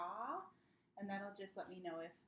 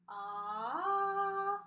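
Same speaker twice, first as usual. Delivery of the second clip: pitch glide up; 11 semitones higher, 11 dB louder than usual. Shown as a demonstration. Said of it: "it's gonna go like this".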